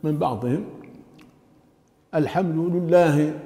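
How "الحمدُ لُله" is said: "الحمد لله" with the first lam wrongly given an u, "al-hamdu lullah"; lam with damma. Shown as a demonstration. Teacher showing learners a common mistake, first lam voweled u. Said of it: "ضم اللام الأولى".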